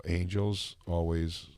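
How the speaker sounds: low, soft, drawn-out words; imitated slow, hushed delivery; slow impersonation. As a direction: stretch each word, quiet and low. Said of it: "He's like".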